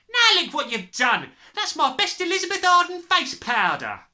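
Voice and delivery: high, loud scolding; shrieking. A narrator's reading. Mother upset about her spilled powder, shrieking. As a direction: shriek at him over the damage. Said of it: "shrieked the mother".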